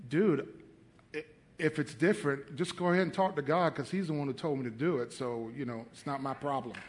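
low, soft, casual brush-off; unbothered retold reply. Answering the doubting pastor that God told him, unbothered. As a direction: hand it off quietly, as no concern of yours.

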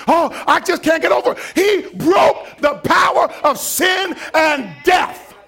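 loud, high shouting sermon; full-force preaching. Acted. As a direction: preach it hard to the congregation.

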